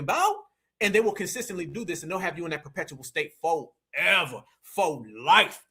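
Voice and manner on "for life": normal talking voice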